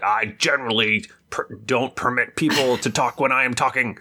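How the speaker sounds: stern teacher voice. Retold or imitated. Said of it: "McGonagall is just like".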